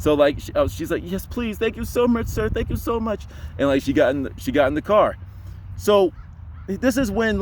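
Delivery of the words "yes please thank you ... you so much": imitated grateful woman. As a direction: imitate a distressed woman accepting help. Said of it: "found out just by the voice".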